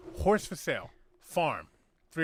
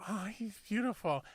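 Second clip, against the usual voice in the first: slow, lightly put-on impeded speech; a hint of the character's speech impediment; 5 semitones higher, 6 dB quieter, slower than usual. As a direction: joke teller voicing the little man at the door. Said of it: "he's got a fucking speech impediment".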